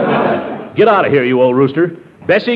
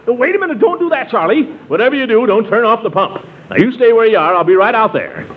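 high, hurried alarm; urgent and alarmed; 9 semitones higher than usual. Real, not acted. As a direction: stop him before he shuts the pump. Urgent phone call, pump noise.

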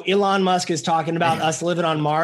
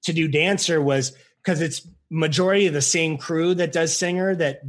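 usual speaking voice

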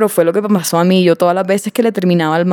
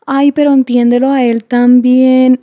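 slow, higher mocking sing-song; imitating pleading friends; 5 semitones higher, 4 dB louder, slower than usual. Mimicking friends who side with the ex, mock pleading. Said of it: "tus amigas te estén diciendo como".

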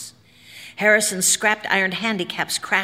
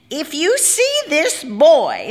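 high, slow and emphatic character voice; high-pitched, loud ballerina, urgently instructing; ballerina's warning; 8 semitones higher, 5 dB louder, slower than usual. A narrator's reading. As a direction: raise the voice high and stretch the words out in warning. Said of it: "said the ballerina".

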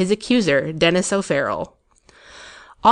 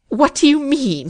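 an indignant splutter from the accused; 8 semitones higher, 4 dB louder than usual. A narrator's reading. The dean sputtering back at O'Farrell's accusation. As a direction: splutter it, higher and louder. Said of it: "he spluttered".

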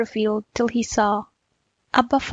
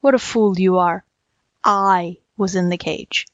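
lowered voice, slowing on the correction; impatient tiger; 3 semitones lower than usual. A narrator's reading. Tiger correcting the muddled jackal, impatient and low.

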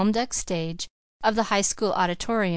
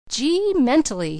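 awed gasp, slow and higher; gasping in awe; 6 semitones higher, 4 dB louder than usual. A narrator's reading. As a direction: catch your breath in wonder. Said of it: "he gasped".

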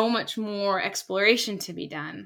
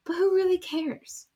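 high despairing question; 9 semitones higher than usual. Retold or imitated. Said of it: "I was like".

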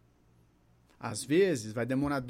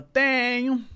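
higher, louder one-word reply; patient's answer; 7 semitones higher, 6 dB louder than usual. Retold or imitated. Dona Maria answers the therapist, high loud patient voice. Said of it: "Ela fala".

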